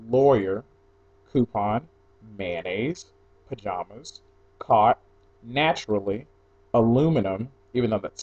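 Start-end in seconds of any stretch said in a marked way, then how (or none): none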